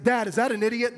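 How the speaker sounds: a hint of a child's voice asking his dad from the back seat; little boy asking. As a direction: play a wide-eyed kid asking in innocence. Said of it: "my little boys are in the back seat".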